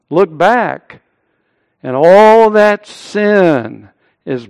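ringing quotation with big swells on key phrases; preaching oratory. Acted. Preacher quoting Spurgeon to the congregation, grand oratory.

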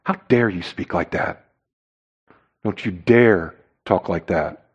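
low, quiet offended rebuke; hushed indignation. Acted. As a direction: voice the offended listener under the breath.

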